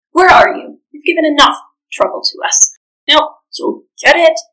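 loud, high, menacing ogre bark; angry ogre shout. Acted. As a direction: yell it out to scare her. Ogre attacks hiding Nakatumba.

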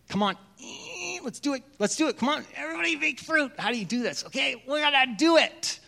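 mock cheerleading one's own old zeal; mock eager self-pep talk. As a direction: pump yourself up, overeager. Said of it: "I always used to think".

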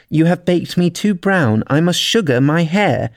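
high-pitched poem declaimed; verse recitation. A narrator's reading. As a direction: declaim the lobster's lines high. Alice recites lobster's line in poem.

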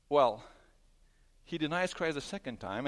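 ordinary talk, nothing marked

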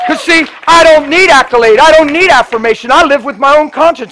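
loud, high, driving preacher's cry; preaching at full force. Acted. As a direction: hammer each line home to the crowd. Preaching climax.

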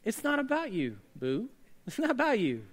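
playful neighbor tease. Pretend. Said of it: "Look at your neighbor real quick and say".

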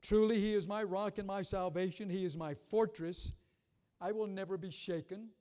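loud, declaiming the psalm; preaching voice, full conviction; scripture read with force. Acted. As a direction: proclaim it to the congregation.

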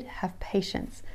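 soft whispered reminder. Shown as a demonstration. Host gently urging readers through the hard chapters, slow whisper. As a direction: whisper "have patience" slowly. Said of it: "to have patience through these readings".

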